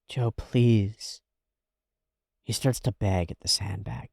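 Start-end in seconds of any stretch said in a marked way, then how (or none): none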